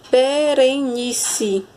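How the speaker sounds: slow, drawn-out "Berenice" in four beats; teacher sounding out be-re-ni-ce; syllable by syllable. Shown as a demonstration. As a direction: stretch each syllable of "Berenice".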